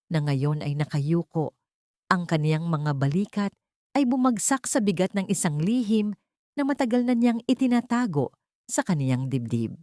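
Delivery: ordinary delivery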